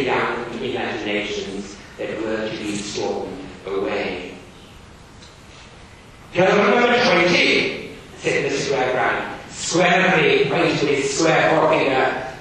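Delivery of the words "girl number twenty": loud, high, stern schoolmaster. Acted. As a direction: bark it like a strict Victorian teacher. Teacher reads Gradgrind barking.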